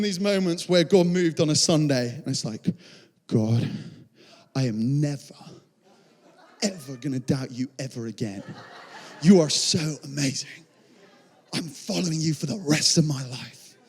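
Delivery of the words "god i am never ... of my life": low, halting, earnest prayer; heartfelt vow. Acted. Believer moved on a Sunday, heartfelt vow. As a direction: vow it with hushed awe, pausing often.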